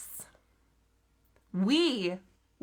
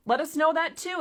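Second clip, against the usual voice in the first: ordinary delivery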